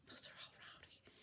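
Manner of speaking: hushed whispered aside. Real, not acted. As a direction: whisper it like a secret. Aside to class about scores.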